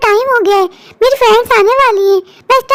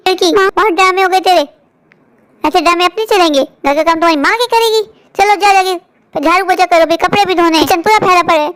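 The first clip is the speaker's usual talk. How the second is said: harsh scolding; 6 semitones lower than usual. Acted. Stepmother orders sick girl.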